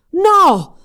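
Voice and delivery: very high, very loud despairing scream; anguished scream. Acted. Sorcerer watching Odette plunge into the lake, piercing scream. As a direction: scream as she falls. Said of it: "urlò Rothbard".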